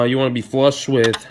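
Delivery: ordinary delivery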